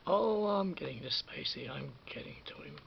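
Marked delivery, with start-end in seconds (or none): none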